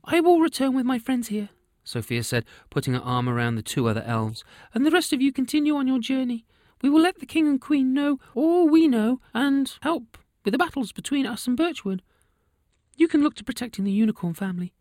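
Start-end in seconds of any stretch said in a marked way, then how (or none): 0.1-1.5 very high, squeaky elf leader voice; a chirpy little elf in charge; christmas elf. A narrator's reading. Elf leader Sophia speaks. 4.7-14.7 very high, squeaky elf leader voice; a chirpy little elf in charge; christmas elf. A narrator's reading. Elf leader Sophia speaks.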